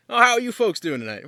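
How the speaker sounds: a hint of a friendly patrol officer checking in; police officer. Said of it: "this police car kinda rolls up".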